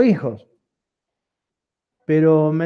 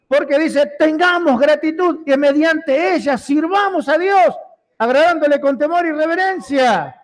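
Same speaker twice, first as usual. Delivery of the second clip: preaching at full force; 10 semitones higher than usual. Acted. Pastor urging the church toward gratitude, full-force preaching. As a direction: preach it hard, rising to a shout at the end.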